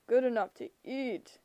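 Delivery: menacing giant voice; monster voice. A narrator's reading. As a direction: leer it like a hungry brute. Cyclops voice.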